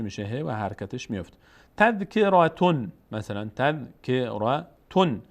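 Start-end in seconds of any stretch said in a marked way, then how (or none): 1.8-2.9 تذکراتون slowly, ending -tun; measured تذکراتون with the tanwin pronounced; full tanwin ending. Shown as a demonstration. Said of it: "اگر بخوام روی این کلمه توقف کنیم". 3.6-5.2 تذکراتون broken slowly into syllables, ending -tun; slow demonstration of تذکراتون with tanwin; full tanwin ending. Shown as a demonstration. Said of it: "اگر بخوام روی این کلمه توقف کنیم".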